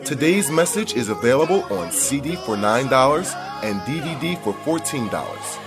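usual speaking voice

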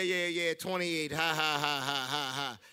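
usual speaking voice

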